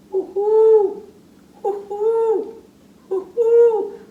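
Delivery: high, loud hooting owl calls; owl hoots. Acted. Puppet owl hooting for the children. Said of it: "what kind of sound do you make".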